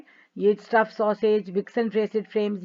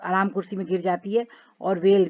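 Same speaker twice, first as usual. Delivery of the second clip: usual speaking voice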